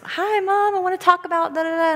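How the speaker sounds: a hint of a teen girl rambling on the phone; imitating her daughter.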